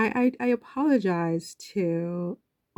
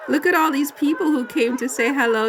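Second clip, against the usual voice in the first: rising, louder with emotion; moved and excited; 7 semitones higher, 5 dB louder than usual. Real, not acted. Vlogger gushing over crowds greeting Harry, louder and higher.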